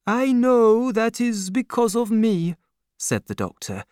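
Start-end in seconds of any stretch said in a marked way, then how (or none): 0.1-2.6 put-on higher-pitched, kindly old father; old doctor's voice. A narrator's reading. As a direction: raise the voice for the aging doctor. Doctor speaking.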